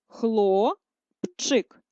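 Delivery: хлопчик split into syllables, slowly. Shown as a demonstration. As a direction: dictate хлопчик split for hyphenation. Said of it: "Ділимо для переносу".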